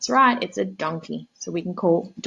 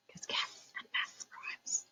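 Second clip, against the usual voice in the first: whispered aside; 19 dB quieter, slower than usual. Real, not acted. Reading to children, whispering an aside about the rhyme.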